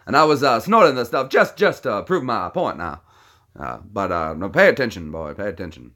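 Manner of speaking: swooping Southern cartoon voice, repeating himself; blustering cartoon Southerner. Streamer explains cacao video as a blustering Southern windbag. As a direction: lecture a boy with folksy bluster.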